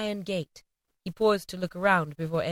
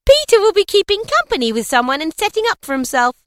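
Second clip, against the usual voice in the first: put-on high, louder teasing girl's voice; a young daughter teasing Peter, high and loud; girl crying out; 12 semitones higher, 8 dB louder than usual. A narrator's reading. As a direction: tease your brother, pitched up and bright. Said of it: "cried one of the girls".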